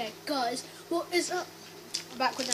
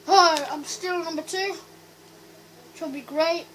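cheeks stuffed full; slower than usual. Real, not acted. More grapes stuffed in.

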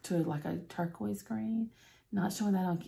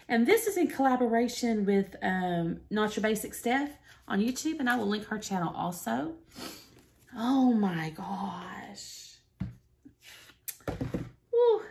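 ordinary talk

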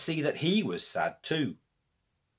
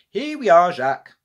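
cheerful announcement; 6 semitones higher, 10 dB louder than usual. Acted. Granny arrives triumphant.

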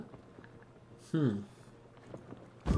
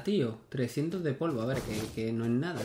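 usual speaking voice

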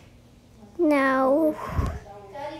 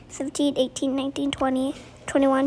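ordinary talk

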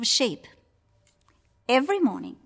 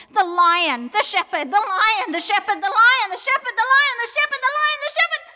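alternating names, climbing ever higher and loud; frantic fight commentary; 11 semitones higher, 5 dB louder, faster than usual. A narrator's reading. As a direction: build to a high frantic peak. Fight builds back and forth.

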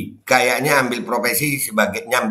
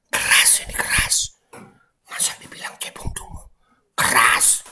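whispered protest; hissed under the breath; hushed whisper; 4 semitones lower, 6 dB quieter than usual. Real, not acted. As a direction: whisper it, no voice at all. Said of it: "Ngomongnya pelan-pelan".

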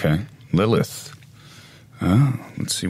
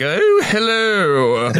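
put-on high, slow, prim old-lady voice; a fluting, matronly "Oh, hello"; high, drawn-out, dainty greeting; Miss Doubtfire-ish; 10 semitones higher, much slower than usual. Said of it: "I'm thinking more of like".

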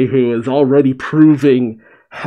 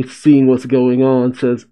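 normal talking voice

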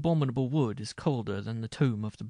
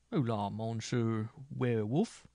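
slow, drawn-out taunting call; mocking cry; slower than usual. A narrator's reading. Minstrel dares the werewolf to appear, mocking. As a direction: call out to taunt, stretching the words. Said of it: "he cried mockingly".

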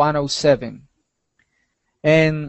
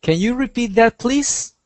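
student asking to repeat; 7 semitones higher than usual. Acted. Asks teacher to repeat question.

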